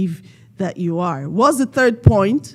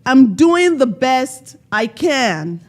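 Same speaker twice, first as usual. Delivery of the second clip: louder, slower, higher and sure; with conviction; 5 semitones higher, 5 dB louder, slower than usual. Shown as a demonstration. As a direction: say it like you mean every word. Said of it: "Are you?".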